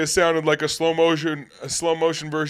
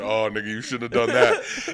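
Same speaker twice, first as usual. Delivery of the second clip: mock slow voice; 5 semitones lower than usual. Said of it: "trying to talk like the dude".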